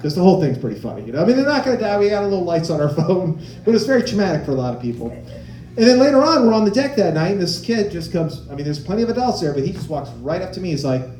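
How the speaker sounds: ordinary delivery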